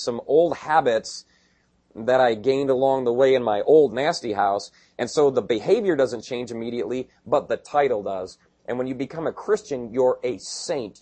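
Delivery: ordinary delivery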